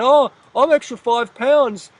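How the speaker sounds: high, loud, hammed-up panic; loud mocking wail; mock freak-out. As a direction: imitate someone panicking over weight, exaggerated and slow. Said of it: "they're freaking out".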